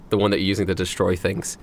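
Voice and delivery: usual speaking voice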